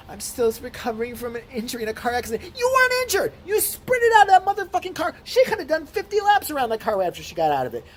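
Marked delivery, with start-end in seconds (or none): 0.1-2.6 a lightly voiced whiny mock of her; mocking impression. Mocking the other driver's injury claim, lightly mimicked. 2.6-6.8 much higher, louder angry rant; outraged yelling. Real, not acted. Ranting at the lying driver, angry yell.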